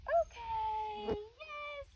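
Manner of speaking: high squeaky okay to the dog; excited release word. Real, not acted. Trainer releasing the dog in a high-pitched voice.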